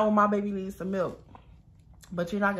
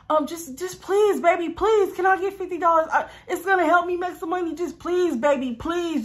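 high, whiny mock pleading; begging; 10 semitones higher, 4 dB louder than usual. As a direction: imitate a man desperately begging for cash. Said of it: "he just kept begging and begging and begging".

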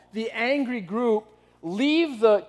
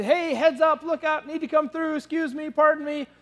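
high, bright, mildly raised calls to clear the way; cheerful excuse-me calls; 8 semitones higher than usual. Retold or imitated. Garbage guy easing past the angry women, cheerful high calls. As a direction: ask people to move with cheer. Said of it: "He's trying to be happy".

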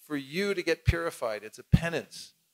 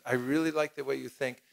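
usual speaking voice

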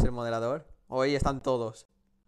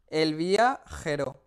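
slow drawn out; much slower than usual. Shown as a demonstration. Repeats the name slowly.

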